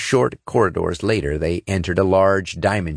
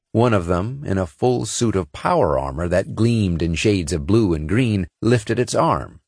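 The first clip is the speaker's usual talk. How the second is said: plain reading voice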